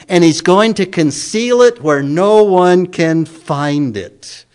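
usual speaking voice

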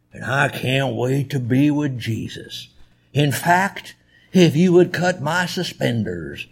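put-on slow, drawn-out old Southern preacher; old Tennessee professor. Old professor telling his students of heaven, slow imitation. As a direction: imitate the old professor, stretching each phrase. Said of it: "He often would say this".

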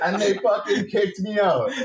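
words through laughter; laughing. Real, not acted. Laughing at own story.

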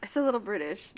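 playful British lilt; lightly British; put-on British accent. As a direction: describe it in a mock-British accent. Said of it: "you went into an accent as well".

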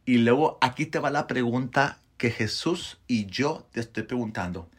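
normal talking voice

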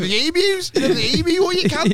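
put-on South African accent. Friend joins the accent bit in a mock South African accent. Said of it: "There's not a bad accent".

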